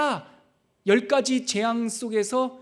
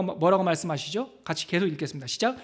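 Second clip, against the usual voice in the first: ordinary delivery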